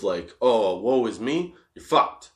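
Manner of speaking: ordinary talk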